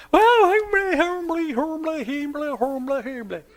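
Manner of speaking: put-on a child's sweet high plea; mock humble child. Dad imitating his daughter, high and sweet.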